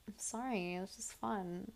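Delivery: hushed, retold excuse; sheepish mock apology. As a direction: apologise small and quiet, imitating him. Voices boyfriend's sheepish apology.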